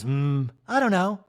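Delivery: high, fast, cheeky rebellious wife; mock high teen girl voice. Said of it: "And Kyle goes".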